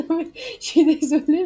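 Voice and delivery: cracking up, high and loud; real giggling fit, voice pushed up loud; apologising through laughter. Real, not acted. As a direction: laugh helplessly while trying to apologise.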